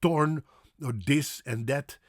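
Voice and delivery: torn for thorn, dis and dat for this and that; hard t and d in torn, this, that; failed TH. Shown as a demonstration. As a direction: Dutch beginner's stops in this and that. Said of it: "I couldn't say".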